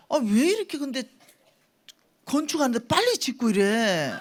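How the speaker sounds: high, loud, incredulous complaint; alarmed protest. Retold or imitated. Preacher recalls panicking over the fast construction, alarmed. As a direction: act out being caught off guard by the speed.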